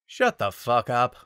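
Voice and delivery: brisk urban snap. A narrator's reading. Falco snapping at Panther, brisk and rude. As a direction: bite it off quickly. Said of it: "Falco's brisk tone".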